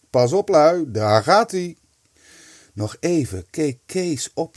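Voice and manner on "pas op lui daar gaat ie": louder, higher heads-up; excited warning. A narrator's reading. Kees warning his friends just before the pinch, excited. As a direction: announce the big moment to your mates.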